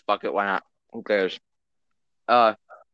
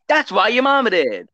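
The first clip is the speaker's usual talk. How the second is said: mocking comeback; 9 semitones higher, 5 dB louder than usual. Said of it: "He's like".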